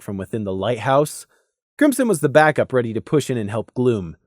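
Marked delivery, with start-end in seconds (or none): none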